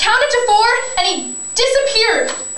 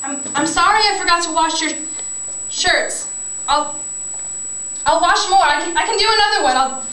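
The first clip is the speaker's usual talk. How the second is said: ordinary talk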